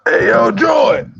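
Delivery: mock outraged shout. Host yelling at Joy for feeding her to the wolves, mock outrage. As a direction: shout at Joy like she betrayed you.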